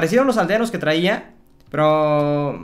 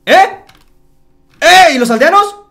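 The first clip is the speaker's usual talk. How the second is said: much higher, much louder shocked yell; shocked shout; 10 semitones higher, 12 dB louder than usual. Real, not acted. Gamer finds his villagers vanished, shocked high yell. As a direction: shriek in disbelief that they're gone.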